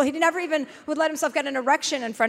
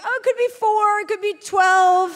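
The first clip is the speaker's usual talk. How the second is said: mock vet voice; 8 semitones higher, 3 dB louder than usual. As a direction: mimic the vague vet. Mocking vague vets.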